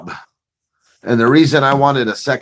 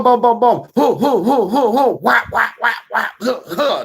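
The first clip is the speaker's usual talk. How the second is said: much higher booms, hoos and whaps; imitated work noises; 12 semitones higher than usual. Narrator imitating boss making pizza, rhythmic noises. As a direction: sound out his fast pizza moves.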